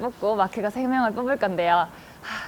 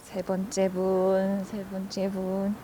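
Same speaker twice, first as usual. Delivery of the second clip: slow, much softer build-up; suspenseful hush; 3 semitones lower, 7 dB quieter, slower than usual. Real, not acted. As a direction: draw out the suspense quietly. Suspense before third draw.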